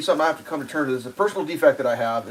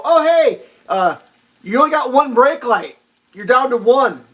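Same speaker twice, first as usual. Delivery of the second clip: high, loud and friendly; loud friendly call; 8 semitones higher, 5 dB louder than usual. Retold or imitated. Telling the driver her brake light is out, high and loud. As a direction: call it across to the other car. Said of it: "I go".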